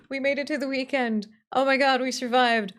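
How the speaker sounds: ordinary talk, nothing marked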